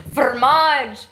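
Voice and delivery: Fromage said loud and pseudo-French, fuh-RMAHJ; hamming up Fromage as if French; mock French. Shown as a demonstration. Joking to viewers that the word is French, hammed-up accent. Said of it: "It's French".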